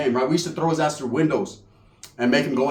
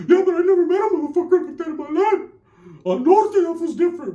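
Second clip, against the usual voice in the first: high, strained panicky protest; imitating a rattled cousin, pitched way up; freaked-out primo; 12 semitones higher than usual. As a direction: protest in a much higher put-on voice. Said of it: "My primos over there all freaked out".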